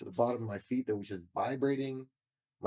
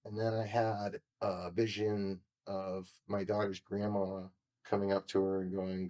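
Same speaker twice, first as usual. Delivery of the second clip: ordinary talk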